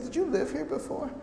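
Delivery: very high-pitched mock astonishment; puzzled disbelief. Hospital people puzzled by the newcomer's many friends, astonished. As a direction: imitate a baffled colleague's question.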